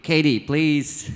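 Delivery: usual speaking voice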